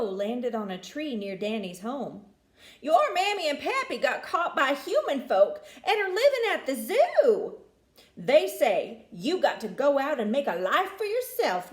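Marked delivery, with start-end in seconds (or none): 2.8-11.7 high, loud squawky crow; a brash bird bringing bad news, pitched up. Crow speaks to Danny.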